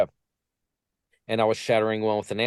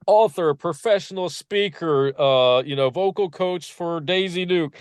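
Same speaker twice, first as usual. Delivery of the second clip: higher-pitched mimicry of grand titles; mock self-introduction; 6 semitones higher, 3 dB louder than usual. Mimicking everyone listing their titles, in a raised mock-important voice. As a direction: reel off credentials in a raised, put-on voice.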